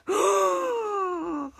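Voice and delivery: long, drawn-out gasp; exaggerated gasp from a crowd; mock shocked gasp. Everyone hears who the impostor is, long gasp. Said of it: "everyone in, in the room except A one gasped".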